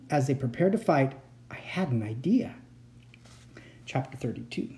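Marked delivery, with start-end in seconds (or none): 1.5-2.6 soft, hushed realisation; whisper. A narrator's reading. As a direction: whisper the idea.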